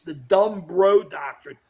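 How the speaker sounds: "dumb bro" slowly spelled out by voice; slow, deliberate "dumb bro"; mocking emphasis. Real, not acted. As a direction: land hard on DUMB, then BRO. Repeats the coined name for emphasis.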